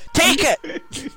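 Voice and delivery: very high, very loud burst; mock motivational yell. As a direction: yell it like an over-the-top hype speaker. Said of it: "this guy going".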